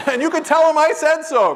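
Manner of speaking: very high, loud mock insistence; emphatic retort. Spiritual leader writes to the sister, crowing. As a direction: crow it triumphantly.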